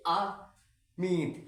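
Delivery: अमित drawn out slowly on the i; long heavy i. Shown as a demonstration. Teacher reads out the big matra, long heavy i. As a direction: split अमित after the a and hold the i long. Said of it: "भारी आवाज़ में बोलेंगे".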